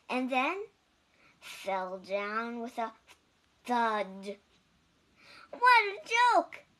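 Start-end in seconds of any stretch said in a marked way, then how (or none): 5.5-6.6 high, loud mocking bird voice; jeering robins. A narrator's reading. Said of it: "said the robins".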